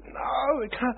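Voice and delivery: high-pitched quick denial; lightly voiced protest from the kid; a touch of a defiant young man; young guy objecting. Young guy denies Jesus.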